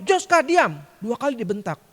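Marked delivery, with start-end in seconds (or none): 0.1-0.8 loud teacher's snap; bentakan guru. Retold or imitated. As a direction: bark the order like an angry teacher. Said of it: "dibentak lagi dua kali". (bentakan keras menirukan guru)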